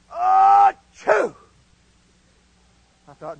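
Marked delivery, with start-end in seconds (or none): 0.1-1.3 huge, high, loud sneeze; explosive mock sneeze. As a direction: let a comic sneeze burst out. Acts out the sneeze in rank.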